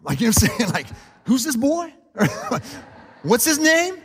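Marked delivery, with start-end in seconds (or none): 1.2-4.0 high, loud, half-laughing demand for the culprit; hammed-up dad ready to go after the kid; mock protective dad. Dad imitating himself hearing about his son's bully, mock outrage. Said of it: "as a dad, man, you're like".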